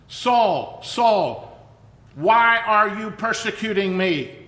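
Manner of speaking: calling out loud from above; voice from heaven. Acted. Voice of heaven calling Saul to stop, loud and commanding. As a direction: boom the name down like a voice from heaven.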